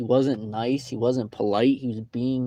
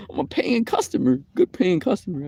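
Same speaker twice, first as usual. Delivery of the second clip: indignant high; 8 semitones higher, 4 dB louder than usual. Retold or imitated.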